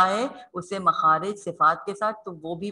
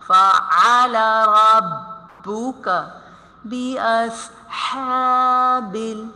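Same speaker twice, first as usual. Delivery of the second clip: slow melodic tajweed chant; Quran recitation; 4 dB louder, much slower than usual. Acted. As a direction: recite it slowly and drawn out. Recites with the class.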